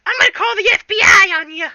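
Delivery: fast, high and loud squeaky threat. Acted. Tech support squeaks a fast threat at Jack. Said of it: "They're like".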